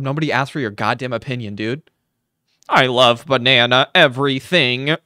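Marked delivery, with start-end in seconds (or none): 2.7-5.0 loud mocking echo. Throwing the man's banana opinion back, mocking. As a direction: parrot the line back loudly.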